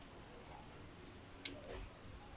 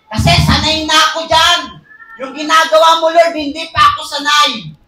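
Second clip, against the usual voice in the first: loud, pleading mock whine; whining complaint to God; 5 semitones higher, 6 dB louder than usual. Preacher mocking a believer begging Lord, loud whine. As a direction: beg Lord to stop like a hurt child. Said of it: "Sasabihin ba natin kay Lord na".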